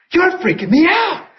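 high-pitched imitation of a freaked-out woman; freaked-out mock quote. Woman to the preacher, freaked out. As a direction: exclaim it high, as if totally thrown. Retelling her freaked-out reaction.

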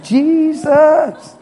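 high, drawn-out cry of Jesus; loud, high exclamation; loud worship shout. Acted. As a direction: belt out the name in praise. Acting out worship while canning.

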